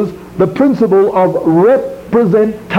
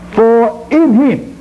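"in" raised high and drawn out slowly; reading Colossians aloud, "in him" stretched high; slow emphasis on in him; 6 semitones higher, much slower than usual. Shown as a demonstration. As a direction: linger on "in him". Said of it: "I will emphasise certain words".

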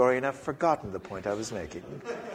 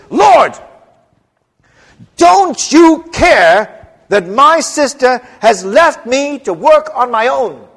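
much higher, loud, slow outraged cry; indignant shout; 11 semitones higher, 12 dB louder, slower than usual. Acted. Martha bursting in on Jesus, indignant shout. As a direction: storm in and demand an answer at full force. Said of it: "bursts in with all her strength of mind".

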